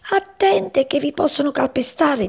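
high, agitated warning; urgent warning. A narrator's reading. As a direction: warn them before they get trampled. Agitated mouse warns frogs.

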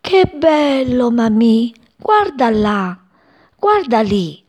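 high, slow, awestruck chick; enchanted. Acted. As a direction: marvel at the view below. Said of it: "incantato, e diceva". (voce acuta e incantata)

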